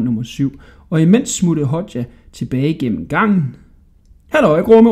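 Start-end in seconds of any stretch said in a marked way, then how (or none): 4.3-4.9 loud, high hail; calling out. A narrator's reading. Hodja back in the other cell, calling out high and loud. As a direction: holler a greeting to the jailer. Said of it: "råbte han".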